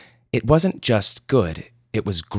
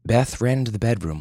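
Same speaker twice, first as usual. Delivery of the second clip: ordinary delivery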